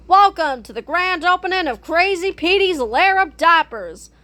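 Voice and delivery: plain reading voice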